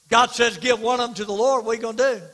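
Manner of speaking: loud, high, fervent preaching; full-force preaching. Acted. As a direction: thunder it out from the pulpit.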